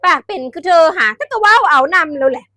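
mock loud friend urging you on; loud quoted friend. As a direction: belt the friend's line out. Quoting friend, raised voice.

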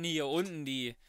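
ordinary talk, nothing marked

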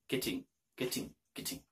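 "getting" whispered with a sharp t. Shown as a demonstration. Teacher drilling "getting" softly in a whisper. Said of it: "Are you getting?".

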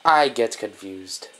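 low, soft, muddled British dog; confused dog in a shaky British accent. Pretend. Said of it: "That sounded Scottish".

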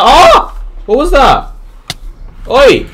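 high, loud cries of alarm; startled yelling. Real, not acted. Gamer startled by scary event, startled yelling. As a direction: yelp in sudden fright.

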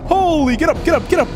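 high frantic shouts of urging; urgent yelling. Real, not acted. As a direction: yell at it to hurry. Urging slide while hunted.

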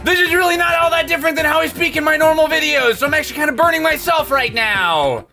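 TV salesman voice. Shown as a demonstration. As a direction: sell it at full volume, never dropping. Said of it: "the trick to being a two thousands, uh, T V salesman".

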